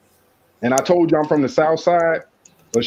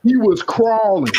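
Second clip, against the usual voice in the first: normal talking voice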